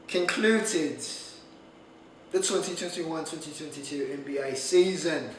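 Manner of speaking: lightly put-on British accent; a touch of British in the voice. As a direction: slip into a mild mock-British announcement. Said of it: "I went to a British accent".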